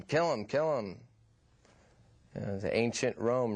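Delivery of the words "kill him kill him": higher-pitched mock cheer of a soccer mom; half-hearted imitation of a screaming sideline parent. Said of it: "soccer moms screaming".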